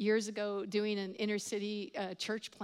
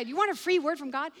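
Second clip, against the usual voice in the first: higher, louder, quick imitated offer; eager offer; 5 semitones higher, 6 dB louder, faster than usual. Prayer friend pitching to drug dealer, imitated eagerness. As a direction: blurt it out like the overkeen friend. Same offer, told again.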